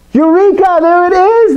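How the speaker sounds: high, exclaimed cry of discovery; acted high-pitched eureka moment; Archimedes' Eureka. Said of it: "Archimedes in his bathtub. What does he say?".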